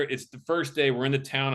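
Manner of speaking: ordinary delivery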